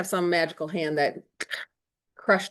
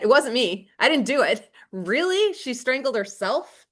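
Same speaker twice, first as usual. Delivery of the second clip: high, loud mocking disbelief; incredulous teacher mocking Othello's logic; sarcastic outrage; 7 semitones higher, 4 dB louder than usual. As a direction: scoff at his claim, pitched way up. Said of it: "What a scumbag, right?".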